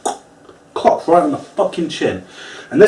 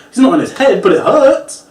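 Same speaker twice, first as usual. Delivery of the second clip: ordinary talk, nothing marked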